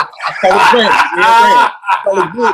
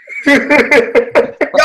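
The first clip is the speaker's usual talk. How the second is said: high burst of laughter; laughing; 6 semitones higher than usual. Real, not acted. Telling friends about the jersey whisperer, laughing. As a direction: crack up at your own story. Laughs at his own story.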